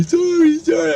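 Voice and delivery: squeaky high falsetto sorry; mock woman apologizing. Neighbor woman waving at him, mocked in high falsetto. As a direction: parody her sugary apology way up high.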